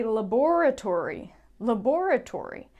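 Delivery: laboratory said in British style, stress on BOR: la-BOR-a-tory; British laboratory, stressed on the second beat. Shown as a demonstration. Teacher showing the British way to say laboratory. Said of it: "If you're British".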